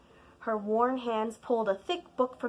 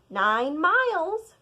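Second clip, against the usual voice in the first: louder, higher, slow; grandma reminding; 7 semitones higher, 7 dB louder, slower than usual. Acted. Grandma reminding child of distance, slow. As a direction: remind slowly and firmly.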